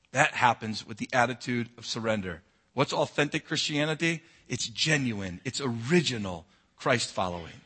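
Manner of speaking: low, soft, intimate sermon voice; soft preaching. Acted. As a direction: draw them in quietly, almost confiding. Quiet reflective altar-call stretch.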